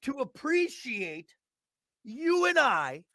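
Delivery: slow, high, hammed-up host voice; mock-solemn tribute to themselves; grand announcement. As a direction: savor every word like a gala host.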